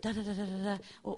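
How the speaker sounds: normal talking voice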